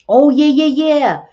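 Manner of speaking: loud, high excited yeah; excited shout. Real, not acted. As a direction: burst out with excitement. Sudden loud reaction to chat.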